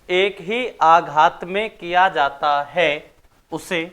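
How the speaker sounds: slow, deliberate dictation pace, phrase by phrase. Acted. Teacher dictating notes to the class, slow and measured. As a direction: read it out slowly for pupils to write down.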